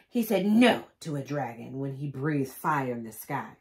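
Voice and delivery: usual speaking voice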